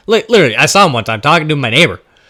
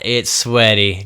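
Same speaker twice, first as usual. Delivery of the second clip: ordinary delivery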